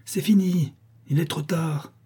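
high-pitched dismayed cry. A narrator's reading. La Chouette seeing the chance slip away, crying out. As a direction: lament it, pitch jumping up. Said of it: "s'écria la chouette".

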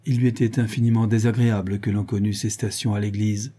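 ordinary delivery

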